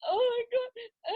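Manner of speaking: high, loud, drawn-out mock wail; imitated crying. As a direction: act out children bursting into tears. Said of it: "just started crying".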